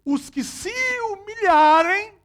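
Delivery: high, loud and slow proclamation; preaching shout. Acted. Preacher proclaiming the scripture to the congregation, loud and slow. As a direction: lift the quote up and stretch it out.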